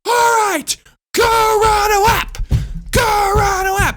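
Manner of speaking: high, loud, slow barked command; teacher's loud order. Retold or imitated. Teacher sends the boys to run a lap, loud high command. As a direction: order them out like a drill sergeant.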